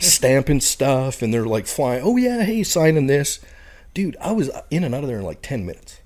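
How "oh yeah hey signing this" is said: high, louder mock enthusiasm; pitched-up retold busy chatter; imitated eager official. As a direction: play an official rushing someone through. Imitating officials eagerly stamping papers.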